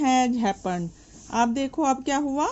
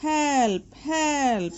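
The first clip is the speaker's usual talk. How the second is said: crying for help; 4 semitones higher than usual. A narrator's reading. Trapped geese calling for help, mildly frightened. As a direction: call out for help in panic. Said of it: "cried the geese".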